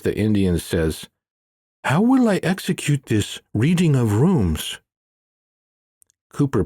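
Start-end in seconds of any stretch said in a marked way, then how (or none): 1.8-4.8 higher, earnest curious voice; a keen pupil pressing for more; higher pitched, earnest Manjunath. A narrator's reading.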